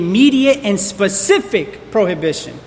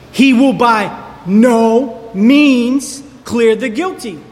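slow weighty quote; slower than usual. Real, not acted. Pastor reading God's warning to the congregation, slow and weighty. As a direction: let each word of the verse fall heavily. Preacher quoting the verse slowly.

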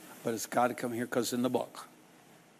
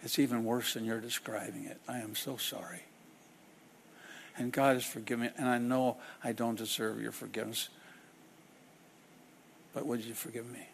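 humble reply; 3 semitones lower, 8 dB quieter than usual. Acted. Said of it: "Humility looks back at that person and says".

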